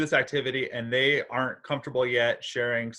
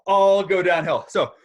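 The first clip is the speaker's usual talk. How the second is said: winded after running downstairs; out of breath; 5 semitones higher, 8 dB louder than usual. Real, not acted. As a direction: talk while catching breath. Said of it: "I'm a little out of breath".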